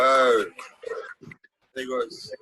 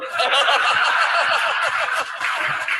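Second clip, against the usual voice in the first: loud burst of laughter; hearty laugh; 11 semitones higher, 8 dB louder than usual. Real, not acted. Telling a team story, a loud burst of laughter.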